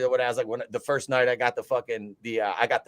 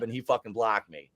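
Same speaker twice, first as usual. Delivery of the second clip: ordinary talk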